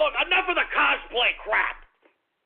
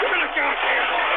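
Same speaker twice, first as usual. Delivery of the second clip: higher, louder barked demand; angry demand; 6 semitones higher, 3 dB louder than usual. Acted. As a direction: snap at the crew for the mic. Host demands mic angrily.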